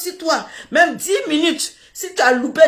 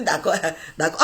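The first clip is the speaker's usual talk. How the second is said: d'accord résigné; 10 semitones lower, 6 dB quieter than usual. Retold or imitated. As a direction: tout bas et grave, résigné. D'accord très bas. (d'accord marmonné, résigné)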